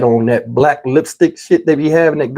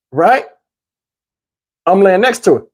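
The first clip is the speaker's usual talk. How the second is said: ordinary talk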